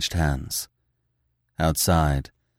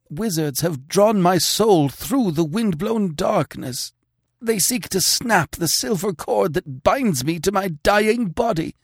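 high, strained, haunted pleading; eerie distant plea; 13 semitones higher, 3 dB louder than usual. Acted. King's soul begs his sister to save him, high and haunted. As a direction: beg for rescue from a nightmare far away. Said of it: "weirdly dreeing cry from realms immeasurable".

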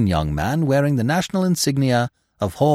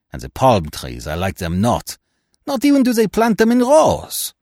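Poirot. Acted. Detective finding fault with pyramids and palm trees, lamenting. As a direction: complain fussily, ending in an indignant outburst. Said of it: "I cut short his lamentations".

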